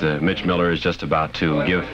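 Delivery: ordinary delivery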